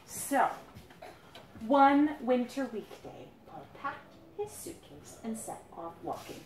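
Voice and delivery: storybook narration for little ones; reading to children. A narrator's reading. Parent reading a picture book to the children, storybook voice. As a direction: read it to the kids gently.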